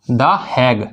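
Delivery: "द हैग" said very slowly, each sound drawn out; slow stressed Hague. Shown as a demonstration. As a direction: stretch "द हैग" slowly like writing it on the board. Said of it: "हैंग की जगह हैग पढ़ना है".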